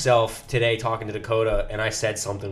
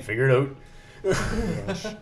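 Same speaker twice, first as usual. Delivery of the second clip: a hint of Minnesota, "out" rising high and trailing off with a laugh; lightly imitating a friend's Minnesota "out"; Minnesota accent; 4 semitones higher, 5 dB quieter, much slower than usual. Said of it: "and he was saying".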